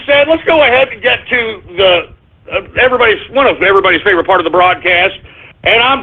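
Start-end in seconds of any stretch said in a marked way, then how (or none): none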